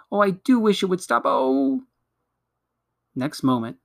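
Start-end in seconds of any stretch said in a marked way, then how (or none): 0.1-1.8 high-pitched wail rising at the end; distressed plea. A narrator's reading. Susan is dragged by magic, distressed. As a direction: wish desperately for it to end. Susan wishes the pulling would stop.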